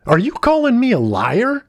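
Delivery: high, loud indignant teacher; outraged shout. A narrator's reading. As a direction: shout it as an affronted challenge. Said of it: "shouted Mister Smith".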